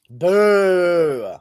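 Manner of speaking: loud boo. Real, not acted. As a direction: boo him hard like a stadium crowd. Rallying chat to boo Noah.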